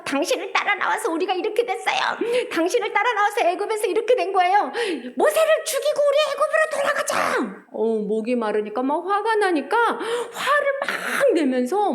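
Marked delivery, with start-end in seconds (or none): 0.0-7.6 higher-pitched angry complaint at Moses, half-laughing; storyteller playing an angry, complaining crowd. Israelites angrily blaming Moses for thirst, a mock-angry higher voice. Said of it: "갑자기 모세한테 화를 내면서".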